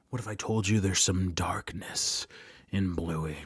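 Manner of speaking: hushed, teasing whisper; soft spooky whisper about a dark secret. Acted.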